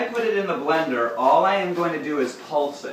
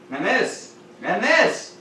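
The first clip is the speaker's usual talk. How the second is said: building excitement; 8 semitones higher, 4 dB louder, slower than usual. Real, not acted. Rising excitement over food.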